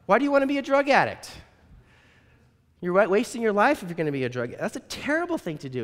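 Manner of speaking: high, fast scolding granny voice; old woman scolding her abductor, high and loud; scolding old lady. Retold or imitated. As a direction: tell him off, pitched high and quick. Doris scolds the drug addict.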